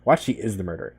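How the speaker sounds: usual speaking voice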